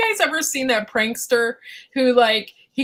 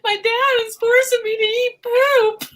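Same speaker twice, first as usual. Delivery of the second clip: high whiny put-on voice; a child complaining about her dad; mock child complaint; 11 semitones higher than usual. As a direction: whimper like a wronged kid. Joking as traumatized kid.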